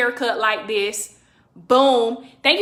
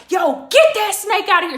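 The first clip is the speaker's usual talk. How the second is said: higher-pitched mock cop order; cussing out; 6 semitones higher, 3 dB louder than usual. Cop cussing out the snake man on the ground, mock angry. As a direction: imitate an officer chewing someone out. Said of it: "So was cussing him out".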